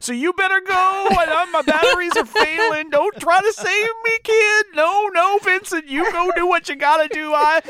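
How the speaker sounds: put-on high, slow, dying old prospector drawl. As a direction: wheeze out a dying old-timer's farewell. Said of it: "Bob is enough of an old prospector".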